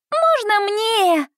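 put-on high, slow little girl asking; toddler voice. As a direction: plead like a tiny child. Toddler Minnie asks.

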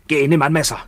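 high, loud order; urgent command. Acted. Returned soldier urges the emperor's daughter to fight, a loud command. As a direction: push her hard to take up arms.

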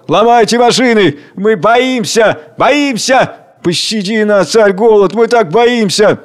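high frightened cries, very high on Боимся; panicked crowd. Acted. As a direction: crowd begs in terror. (испуганные крики толпы)

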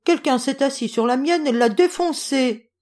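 put-on high-pitched baby bear whine; little bear's mock whimpering complaint; tiny whimpering voice. A narrator's reading. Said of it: "pleurnicha bébé ours de sa toute petite voix".

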